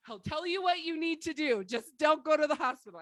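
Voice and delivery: loud, high, pleading urgent message; urgent plea. Retold or imitated. Texting friends with covid, urgent loud plea. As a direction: beg them not to go, loudly. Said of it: "That's what I text people".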